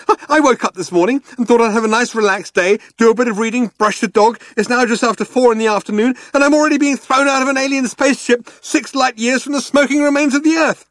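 high, fast, hysterical rant; complaining. A narrator's reading. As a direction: pile up the grievances breathlessly. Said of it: "complained Arthur".

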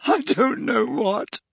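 high, slow and tearful. Acted. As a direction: choke out the words. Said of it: "sobbed Leanne".

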